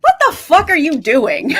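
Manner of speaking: laughing mock outrage at a foolish hero; half-laughing exasperated outburst. Imagined reader laughing at the hero, exasperated. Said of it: "you're gonna be like".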